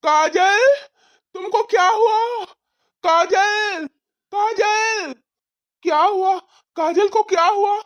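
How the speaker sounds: frantic worry at the sight of her; panicked shock. Acted. Husband seeing injured wife, crying out in high panic.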